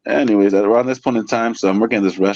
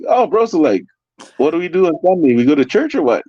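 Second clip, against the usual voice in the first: high, excited retold question; giddy mock curiosity; amused questioning; 10 semitones higher, 3 dB louder than usual. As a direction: ask it high, on the edge of laughter. Retelling his amused question.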